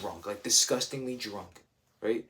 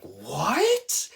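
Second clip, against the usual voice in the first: stunned what; 7 semitones higher, 7 dB louder than usual. Retold or imitated. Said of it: "I'm like".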